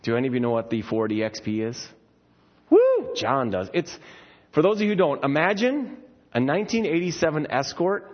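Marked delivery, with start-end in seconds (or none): 2.7-3.1 excited woo. Real, not acted. Cheering a car nobody knows.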